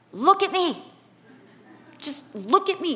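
lightly acted parent demanding attention; insistent parent. Parent to an upset child, insistent command. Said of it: "Did you ever do that to your kids?".

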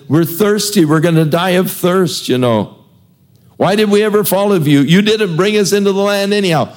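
high, fast, whiny grumbling crowd; whining complaint. Acted. Thirsty people griping at Moses, high and fast whining. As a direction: gripe at him like parched complainers.

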